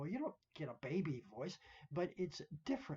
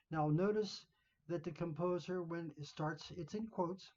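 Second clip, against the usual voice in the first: ordinary delivery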